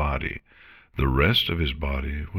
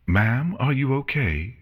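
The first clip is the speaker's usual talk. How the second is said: a lightly voiced nurse, higher and anxious; worried nurse checking on Chrysalis, higher voice; 9 semitones higher than usual. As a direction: ask with gentle worry, pitched up. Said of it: "she said worriedly".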